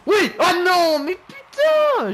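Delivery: high, loud cry of frustration; furious gamer outburst; ear-splitting yell. Real, not acted. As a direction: shriek in real dismay as the chance goes. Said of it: "Je m'excuse pour les oreilles".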